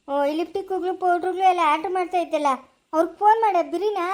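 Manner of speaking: usual speaking voice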